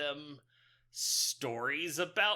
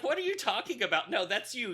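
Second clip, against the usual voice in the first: quick, raised-pitch imitation of a baffled sister; mock bafflement; 6 semitones higher, 4 dB louder, faster than usual. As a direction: retell her objection in a rush. Acts out baffled sister's reply.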